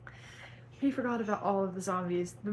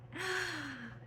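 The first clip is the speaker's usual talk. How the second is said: dramatic gasp; 9 semitones higher, 6 dB quieter than usual. Acted. Dramatic reading of fanfic.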